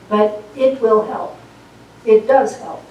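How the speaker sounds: ordinary delivery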